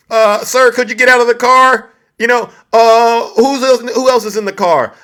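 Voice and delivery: hammed-up traffic cop. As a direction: play the stern cop ordering them out. Acting out police stop.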